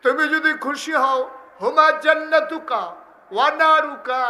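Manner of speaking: high, wailing shout to mother; anguished loud plea. Acted. Son confessing to his mother that he is ruined, wailed at full force.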